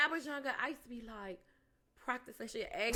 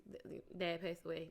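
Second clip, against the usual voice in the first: choked-up stammer; 4 semitones lower, 8 dB quieter than usual. Retold or imitated. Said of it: "And I'd be like".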